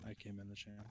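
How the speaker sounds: quiet and far off; hushed like a golf broadcast; golf announcer voice. Real, not acted. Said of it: "What happened to his voice?".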